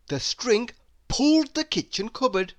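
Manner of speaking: usual speaking voice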